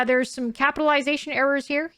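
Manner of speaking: usual speaking voice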